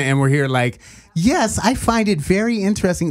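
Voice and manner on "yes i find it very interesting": higher, over-polite put-on diction; mock proper voice. Host imagines proper talk in Atlanta, high and mock-polite. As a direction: play it prim and proper.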